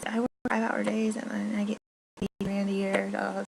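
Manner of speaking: high, sing-song mocking voice; mocking administrator. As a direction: parrot the smug administrator. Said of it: "they'll be like".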